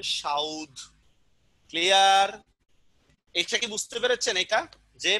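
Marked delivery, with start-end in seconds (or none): 0.0-0.8 সাউদ as "shaud", u before the final d; lower, drawn-out সাউদ with an au glide; epenthetic pronunciation. Shown as a demonstration. Teacher shows the class the shifted vowel, u pronounced early. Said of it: "আগে নিয়ে আসি তাহলে কি হবে?".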